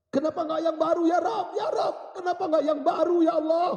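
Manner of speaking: high, loud wailing cry to God; dying man crying out at full force; anguished shout. Acted. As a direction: shout the plea in desperate regret. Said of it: "Dia berteriak".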